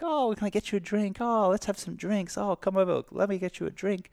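a sing-song, falsely sweet invitation; sweet pushy host. Retold or imitated. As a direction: coax them like a smiling tempter. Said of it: "The smiling assassins who are like".

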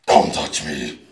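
low, loud growl of warning; stern character voice. Shown as a demonstration. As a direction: warn them off in a scary voice. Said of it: "I definitely do it that way".

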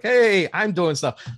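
high, loud and slow mock excitement; excited. Retelling her excitement at the mummy exhibit, mock excited. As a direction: play the giddy kid at the exhibit.

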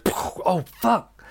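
high startled swear, acted out; startled curse. Shaking man misfires his gun, high startled curse. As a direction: imitate a jolt of panic after a slip.

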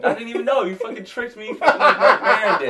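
high loud laughing protest; laughing outrage. Real, not acted. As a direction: play it caught out and amused. Realises he was tricked.

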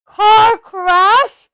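very loud, high bellow of disbelief; furious roar. A narrator's reading. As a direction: roar it in outrage. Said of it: "roared Hagrid".

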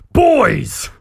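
high, loud orc call; excited orc shout. Acted. Orc captain calls his boys, excited shout. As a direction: holler for the gang in sudden excitement. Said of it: "stands up excitedly".

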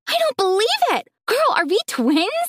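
high, thrilled disbelief; excited. A narrator's reading. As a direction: squeal it with delight. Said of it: "Juliet screamed".

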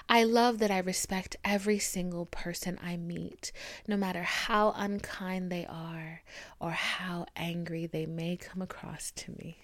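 soft breathy whisper, slow; whispered affirmations. Acted. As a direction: murmur each affirmation gently. Reads self-love affirmations.

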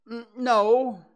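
high, loud, hesitant denial; feigned carelessness. A narrator's reading. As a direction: brush it off in a high character voice. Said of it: "said Pooh carelessly".

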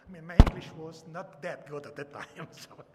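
ordinary talk, nothing marked

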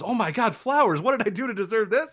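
high, gushing surprise; delighted wife. Retold or imitated. Wife opening flowers, delighted and high. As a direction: squeal over the flowers. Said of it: "she goes".

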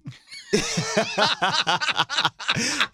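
long high laugh with a cackle; hearty laugh. Real, not acted. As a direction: burst out laughing at the memory. Laughing at own story.

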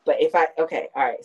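ordinary delivery

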